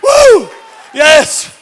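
shouted, high preaching over the crowd; full-force preaching. Acted. Pastor shouting to the church at full force. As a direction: preach it at the top of your lungs.

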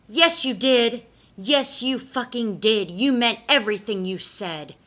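hurt accusation. A narrator's reading. Mister Kink cuts off the Tooth Fairy's apology, hurt and accusing. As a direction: cut in hard and insist, loudly.

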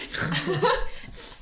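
choking on a drink. Real, not acted. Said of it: "[name] verschluckt sich an seinem sehr guten Bier".

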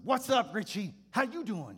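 high, loud, hearty preacher greeting; jolly pastor. Retold or imitated. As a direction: boom it out like a beaming old reverend. Voicing the sweaty old pastor.

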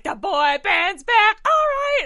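put-on loud, high and slow, goofy boy band cheer; hammed-up boy band shout. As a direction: belt it out like a silly boy band intro. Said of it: "we were weird".

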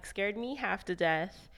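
normal talking voice

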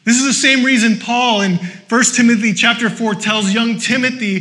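ordinary delivery

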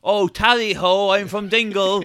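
hammed-up, badly faked Irish accent; drawn-out posh-Brit attempt at an Irish accent. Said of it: "Doing a bad Irish accent".

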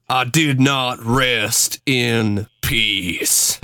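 very slow, hammed-up constipated narrator; corny narrator voice. Mocking the Billy the Kid reenactment, corny drawn-out narrator voice. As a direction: draw it out like a corny ghost story. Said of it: "It was so corny sounding".

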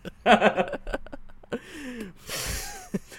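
long, high wheezy laugh; real drawn-out high laughter; long laughing fit. Real, not acted. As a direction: keep laughing helplessly at your own joke. Laughing at own Spanish nickname joke.

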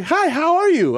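mock a mom who lost her accent; accentless mom. Son imitating his mom without her accent, high mock-polite greeting. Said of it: "if my mom lost her accent".

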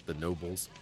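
ordinary delivery, nothing marked

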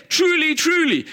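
Truly, truly called out high and loud; preacher making the crowd pay attention; emphatic. Acted. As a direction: hammer both words to grab attention.

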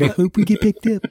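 high-pitched mock timid voice; squeaky-high put-on voice, hoping not to be dropped; anxious hopeful. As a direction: play it as a high, nervous little wish. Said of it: "they were still on eggshells".

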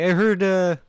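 mock an eager bad impressionist taking requests; open-mic impressions guy. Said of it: "And he's like".

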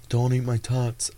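drawn-out, half-hearted character impression; Napoleon Dynamite. Podcaster quoting a movie about tots, terrible impression. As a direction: do a badly faked movie-character voice. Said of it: "that was a terrible impression".

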